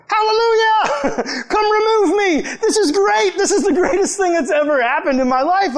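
very high, loud, laughing cheer; mock joy. About the sign that evicts trespassers, a high laughing cheer. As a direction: squeal with delight at being rescued. Thrilled to be thrown off mountain.

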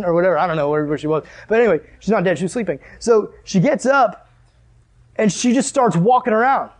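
ordinary delivery

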